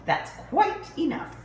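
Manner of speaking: prim mother bear scold; mama bear. Acted. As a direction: tell them off like a proper mother bear. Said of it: "Mama Bear".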